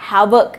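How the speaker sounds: हैवॉक said as "haivok", h fully sounded; careful teacher model of हैवॉक; correct pronunciation. Shown as a demonstration. Teacher shows class how to say हैवॉक. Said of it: "इसे हम लोग बोलेंगे".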